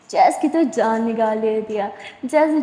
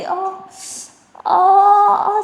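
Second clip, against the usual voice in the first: higher, louder cries of pain; 8 semitones higher, 5 dB louder, much slower than usual. Acted. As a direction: cry out in sudden pain. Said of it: "सारा ज़ोर से चिल्लाने लगते हैं".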